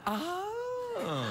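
high, drawn-out oh; mock realization. Host acting boyfriend who gets the hint, mock realization. Voicing the dumped man's reaction.

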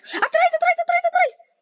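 high-pitched rapid-fire repeated callout; urgent warning. Real, not acted. Gamer warning teammate of someone behind, urgent.

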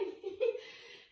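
breathy amused chuckle; soft laugh. Real, not acted. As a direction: chuckle softly at the puppy. Laughs at the puppy.

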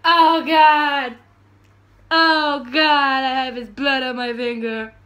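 loud, hammed-up cries of horror; hammed-up anguish. Blood on her finger, loud hammed-up anguish. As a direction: overplay the disgust and guilt.

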